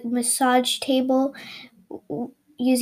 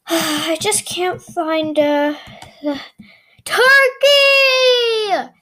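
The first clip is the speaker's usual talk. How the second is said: slow build to a shouted high turkey; rising to a frustrated yell; 7 semitones higher, 6 dB louder, much slower than usual. Acted. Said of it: "yelled the coach".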